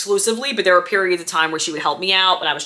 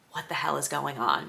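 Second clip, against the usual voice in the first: muttered disbelief; 5 semitones lower, 13 dB quieter than usual. Retold or imitated. Shocked aside, learning of arrest.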